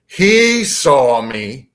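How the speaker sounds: very slow, emphatic mantis boom; imitated alien boom, slow and heavy. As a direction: draw out each word like a booming command. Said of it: "the mantis boomed again".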